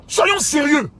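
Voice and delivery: a high, loud burst of exasperation; exasperated shout. Real, not acted. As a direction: cry out "let's be serious". Pasteur s'emporte contre les quémandeurs. (cri exaspéré, plus aigu et fort)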